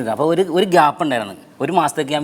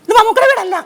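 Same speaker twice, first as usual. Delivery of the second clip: much higher, louder woman's voice; curt woman cutting the caller off; lady on the phone; 8 dB louder than usual. Retold or imitated. (ഫോണെടുത്ത സ്ത്രീയുടെ ഉയർന്ന ശബ്ദം)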